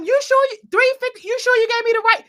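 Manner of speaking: high incredulous you sure; disbelieving question. Retold or imitated. Asking Miss Rachel about the money, high disbelief. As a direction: pitch way up in disbelief checking the amount. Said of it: "I had to ask Miss Rachel".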